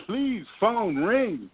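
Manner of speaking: high, slow, drawn-out begging; mock pleading. To himself, wishing for another offer, mock pleading. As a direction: stretch it out like a hammed-up prayer. Begging the phone to ring again.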